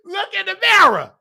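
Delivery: high and loud, calling her out; sassy retort. Retold or imitated. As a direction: throw it back at her with sass. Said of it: "I'm just looking at her being".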